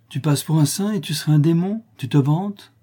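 high, loud disbelieving questions; incredulous challenge. A narrator's reading. Cécilie doubting the notary is a demon, incredulous. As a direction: challenge the boast in amazement.

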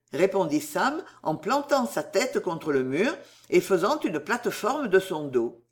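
ordinary delivery

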